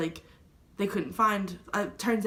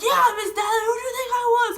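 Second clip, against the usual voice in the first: imitated angry yell, loud and very high; furious retort; 12 semitones higher, 6 dB louder than usual. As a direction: throw it back as an outraged, yelling dad. Retold angry father confronting her.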